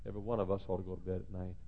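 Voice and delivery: ordinary delivery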